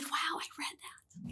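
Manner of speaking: slow, hushed whisper of wonder; soft whispered amazement; awed whisper. Retold or imitated. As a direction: breathe it out slowly like a kid amazed at himself. Child amazed reading sentences.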